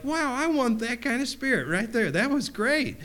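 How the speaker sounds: high, bright-eyed wow of admiration; excited admiration. Retold or imitated. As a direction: sound thrilled by his spirit. Admiring reaction to brother's story.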